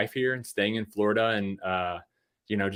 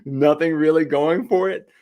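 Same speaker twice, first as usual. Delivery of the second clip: laughing through the words, higher and louder; 7 semitones higher, 6 dB louder than usual. Real, not acted. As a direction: chuckle it out while mocking the town. Laughing at his hometown.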